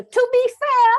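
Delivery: very high, loud British interruption; piercing British put-on, r dropped; British accent. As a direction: cut in with a mock British accent. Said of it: "I just do it with the accent".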